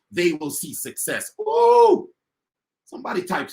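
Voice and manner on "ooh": loud, high-pitched "ooh"; excited whoop. Real, not acted. Speaker whooping to his audience about success, excited whoop. Speaker's own exclamation after point.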